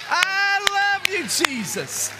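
very high, loud, drawn out; ecstatic praise cry. Real, not acted. Preacher cries out love to Jesus, high and loud.

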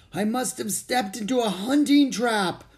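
high, strained, choked-off words; strangled voice. A narrator's reading. Boy hanging upside down in a trap, strangled voice. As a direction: squeeze it out through a tight throat. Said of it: "in a strangled voice".

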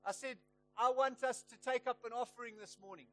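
passionate preaching. Retold or imitated. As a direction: proclaim it boldly to the congregation. Preacher retells stopping the meeting.

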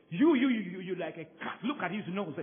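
high, loud mocking jabs; mock insult. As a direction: tease a coworker like a cat. Acts out insulting coworker.

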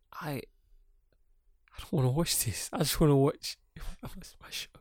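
slow, halting mock whine fading to whisper; pitiful pleading. Frustrated viewer wanting his show, pitiful.

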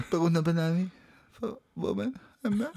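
soft perfume ad whisper; sultry perfume ad. Retold or imitated. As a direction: murmur it like a perfume commercial. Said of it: "Kennst du das Gejammer bei den Parfümwerbungen".

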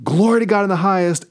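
high, fast proclaiming cry; ringing, high angelic proclamation; angel army shout. Retold or imitated. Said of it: "shouting out".

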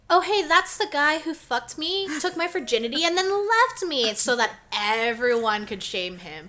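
loud slow public call-out; really loud. Pretend. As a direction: shout it for everyone to hear. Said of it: "You should have been really loud".

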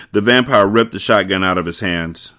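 ordinary delivery, nothing marked

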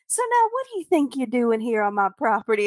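a sugary Southern lady's drawl with menace underneath; syrupy Southern hostess, politely threatening; sweet Southern drawl. Pretend. Southern mom to a guest she's sizing up, sweet drawl. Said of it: "with, like, the sweetest Southern drawl".

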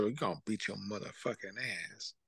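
very soft, low muttered threat; under my breath. Retold or imitated. Substitute teacher threatening kids under her breath, very soft. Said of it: "I'd be under my breath".

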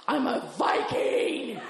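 high, slow mock battle cry; triumphant yell. Stoned woman announcing herself to the party, triumphant yell. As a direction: holler it out, stretching the words. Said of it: "And then I just yelled".

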